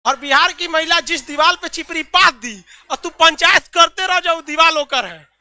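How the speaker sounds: loud, high-pitched joking boast; mock taunt. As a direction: crow it at an imagined rival, playfully.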